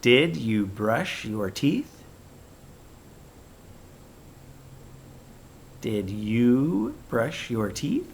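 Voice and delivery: slow, rising on teeth; question tone. Shown as a demonstration. Teacher modelling a question to kids, rising. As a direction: ask like a checking parent. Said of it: "We're asking a question".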